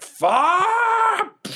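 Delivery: long drawn-out high yell of fuck; slow-motion scream. Acted. GM acting Alan's slow-motion scream, a drawn-out high yell.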